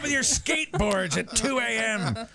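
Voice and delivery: put-on grumpy old man scolding; cranky neighbor yelling at skaters; shouting at trespasser. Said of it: "He's on a roll".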